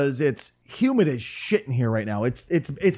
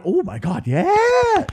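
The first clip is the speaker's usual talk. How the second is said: mock fangirl thrill, pitch shooting up; geeky excitement; 14 semitones higher, much slower than usual. Microphone geek hearing the model name, mock excitement. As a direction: squeal the last word like an excited gear geek. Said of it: "If you are a microphone geek".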